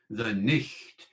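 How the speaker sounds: "nicht" with a throaty ch like German; Middle English ch sound. Shown as a demonstration. Teacher demonstrating the old ch in "nicht". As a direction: rasp the ch in "nicht". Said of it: "another ch sound".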